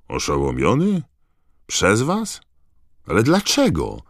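loud questions leaping high in pitch; shocked disbelief. Acted. Bagman learning colleagues stunned the elf, shocked disbelief. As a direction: echo it incredulously, voice shooting up.

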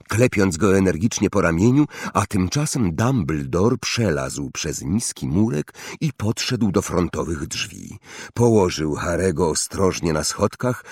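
ordinary delivery

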